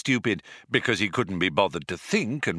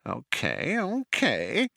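hurt tone; 6 semitones higher, much slower than usual. A narrator's reading. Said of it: "in a hurt tone of voice".

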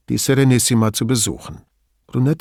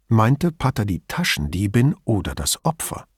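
usual speaking voice

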